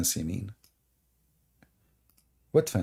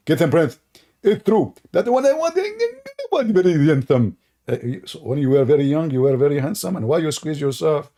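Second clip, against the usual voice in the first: mock rival voice; 4 semitones higher, 3 dB louder than usual. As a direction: play the rival confessing, hammed up.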